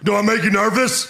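barking drill instructor, high and loud; drill sergeant. Acted. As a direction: bark it like a furious sergeant. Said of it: "I'll be Stone Cold as Hartman".

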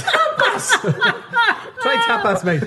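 high-pitched laugh through the words. Real, not acted. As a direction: giggle the words out, cracking up. Cracking up at own joke.

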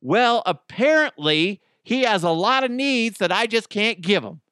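high, loud indignant wife; acted pissed-off wife. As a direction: complain loudly as the fed-up wife.

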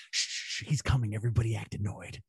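whispered. Retold or imitated. Lady Summer Isle hushing the islanders before he arrives, whispered. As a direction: whisper it like a secret plan.

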